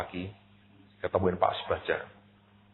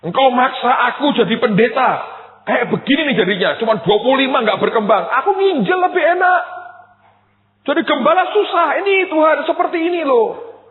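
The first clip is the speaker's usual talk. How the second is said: high, loud aggrieved rant; aggrieved complaint; 9 dB louder than usual. Retold or imitated. Pastor grumbling to God about his calling, high and loud. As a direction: vent the grievance at full pitch.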